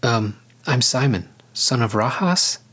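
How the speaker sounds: ordinary delivery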